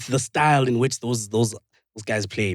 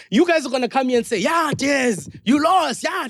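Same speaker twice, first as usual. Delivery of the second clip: high, heated imitation of jeering fans; mock taunting; 13 semitones higher, 3 dB louder than usual. Fans jeering Des after a lost trophy, high mock taunting. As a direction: pile on the taunts, pitched way up and insistent.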